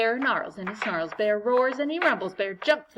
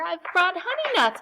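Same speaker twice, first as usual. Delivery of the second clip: higher, louder proud offer; grinning badger; 9 semitones higher, 5 dB louder than usual. Badger offers honey nuts to friends, grinning and higher. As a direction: offer the treats with a grin. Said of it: "badger says with a grin".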